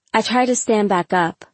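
plain reading voice

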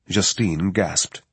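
ordinary delivery

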